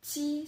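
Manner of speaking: teacher showing the T sound. Shown as a demonstration. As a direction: hit the "T" crisply. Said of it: "pronounced like the".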